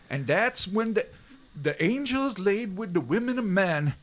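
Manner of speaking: rough go at a sweet Cajun lilt; sweet Cajun accent. Retold or imitated. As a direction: tell it like a Cajun storyteller. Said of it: "With his, like, sweet Cajun accent".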